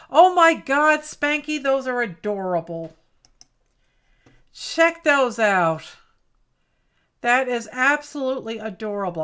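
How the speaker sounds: loud burst of delight, high at the start; delighted gushing. Real, not acted. Crafter seeing a friend's cactus doodle, real loud delight. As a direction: gush loudly, starting high and coming down.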